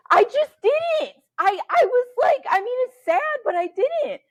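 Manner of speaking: loud, high squeal of self-defence; real squeaky, exasperated defensiveness; high-pitched protest. Real, not acted. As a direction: protest at the top of your range. Protests teasing about not crying.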